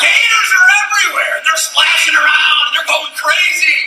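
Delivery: loud, high, building excitement; storyteller yelling over the chaos; excited shout. Acted. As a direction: shout it like the water's churning.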